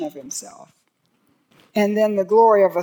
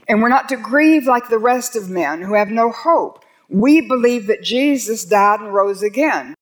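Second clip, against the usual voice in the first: fervent preaching; 2 semitones higher, 4 dB louder than usual. Acted. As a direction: preach it with conviction. Preaching the Thessalonians passage.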